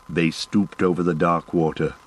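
usual speaking voice

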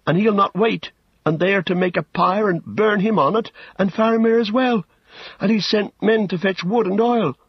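high, urgent Pippin voice; alarmed halfling. Acted. Pippin pours out his tale to Gandalf, alarmed. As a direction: pour it out, high and anxious.

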